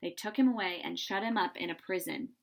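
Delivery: ordinary delivery, nothing marked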